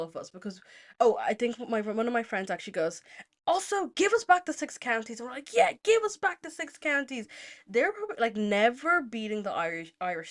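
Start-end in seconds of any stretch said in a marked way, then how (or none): 3.5-5.2 high-pitched mock protest cry; indignant demand. Friend chiming into the rant, high and indignant. 5.5-7.3 high-pitched righteous echo; emphatic agreement. Retold or imitated. Friends echoing one's demand, high and righteous.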